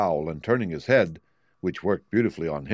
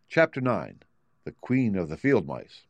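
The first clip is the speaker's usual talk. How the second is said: usual speaking voice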